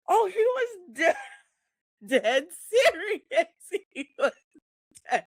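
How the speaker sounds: high voice breaking up with laughter; real laughter. Real, not acted. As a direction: struggle to get words out through laughs. Laughing as she tells it.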